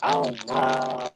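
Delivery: muffled voice on a bad connection. Real, not acted. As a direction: sound muffled, as if through a wall. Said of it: "You too".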